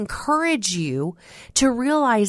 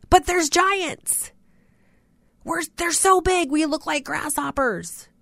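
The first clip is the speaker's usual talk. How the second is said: scared spies; 7 semitones higher than usual. Retold or imitated. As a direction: play the spies as frightened and small. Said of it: "And then they added".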